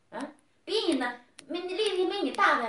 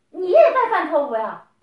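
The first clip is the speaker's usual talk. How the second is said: raised voice, scolding hard; angry scolding; 3 semitones higher, 9 dB louder than usual. Real, not acted. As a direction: drive the accusation home loudly. Scolding husband in a quarrel.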